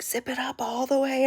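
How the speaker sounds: put-on older son telling his brother, eager; kid's instruction. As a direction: play the bossy older son. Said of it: "my oldest was like".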